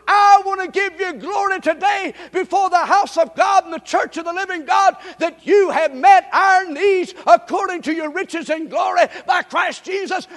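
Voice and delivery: loud, high, shouting praise; whooping preacher crying out praise; preaching at full force. Acted. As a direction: lift the congregation with a roaring testimony.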